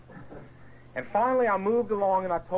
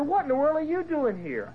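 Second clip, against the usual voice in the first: astonished nanny; 11 semitones higher than usual. Retold or imitated. Nanny recognising him, astonished.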